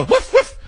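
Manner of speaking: very high-pitched little woofs; mock dog bark. Host plays a dog being called in, high mock barking. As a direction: yip like a small dog.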